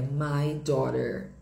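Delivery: slow American "my daughter", tapped T; sotaque americano. Shown as a demonstration. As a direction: say "daughter" slowly as "daw-der", American. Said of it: "no inglês americano fica". (sotaque americano, devagar, com R final)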